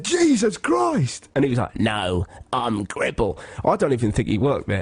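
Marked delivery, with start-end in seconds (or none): 0.0-1.3 very high, stretched-out shock; startled cry. Retold or imitated. Retold shock at staring old man. 1.8-3.4 put-on slow creepy old man; Gribble. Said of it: "some creepy old man".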